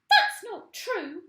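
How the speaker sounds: very loud, high, slow cry of denial; frightened cry. A narrator's reading. Mrs Twit crying out in fright at her husband. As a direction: shriek the denial. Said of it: "cried Missus Twit".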